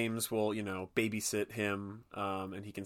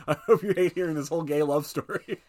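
louder teasing taunt; gleeful taunting; 6 semitones higher, 7 dB louder than usual. Retold or imitated. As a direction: rub it in with glee. Repeated with more punch.

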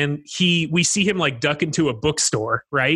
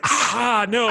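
pained cry; 6 semitones higher than usual. Retold or imitated. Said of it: "and he's like".

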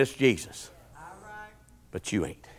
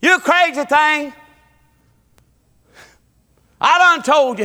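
mock holler; 12 semitones higher, 6 dB louder than usual. Man in the barn scolding his horse, mock holler. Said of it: "He hollered at his horse all the time".

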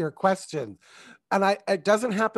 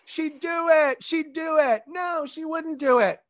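audience calling out; 9 semitones higher than usual. Retold or imitated. Audience arguing over the wife's answer, high mock calling. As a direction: pitch it up like crowd members calling out. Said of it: "The audience is like".

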